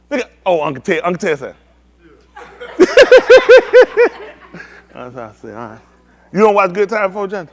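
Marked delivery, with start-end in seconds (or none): 2.4-4.3 loud, high burst of laughter; big laugh. Real, not acted. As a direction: let out a huge, high whooping laugh.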